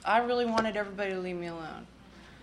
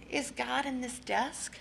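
put-on innocent child question; 5 semitones higher, 8 dB quieter, slower than usual. Child asking teacher innocently.

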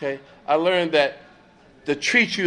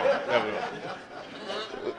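slow whispered aside; whispered repeat; 4 semitones lower, 9 dB quieter, much slower than usual. Shown as a demonstration. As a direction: breathe it out slowly as a whisper.

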